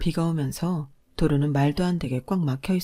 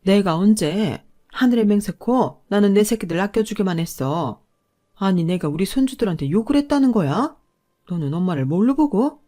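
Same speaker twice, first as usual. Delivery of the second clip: loud, higher offended mother-in-law; indignant denial; 4 semitones higher, 6 dB louder than usual. A narrator's reading. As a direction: protest your innocence loudly. Mother-in-law denies angrily. (억울하다며 버럭 부인하는 시어머니)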